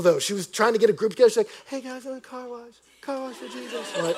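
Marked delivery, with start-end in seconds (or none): 1.7-3.9 tiny, high, soft voice; imitating a shy girl's feeble call; timid rallying attempt. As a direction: squeak it out meekly to a group. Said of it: "she couldn't rally people".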